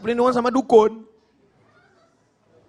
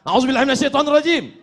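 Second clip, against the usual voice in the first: high, very fast recitation burst; angry; 5 semitones higher, much faster than usual. Shown as a demonstration. Preacher showing seeking refuge while angry, fast high burst. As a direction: fire it off in one heated rush. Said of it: "Kalau sedang marah".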